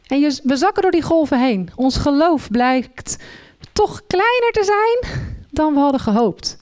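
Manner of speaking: usual speaking voice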